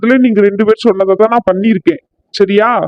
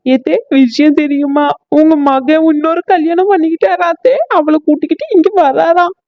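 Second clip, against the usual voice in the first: weepy; 7 semitones higher than usual. Acted. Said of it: "மருமகளே அழுவுறா".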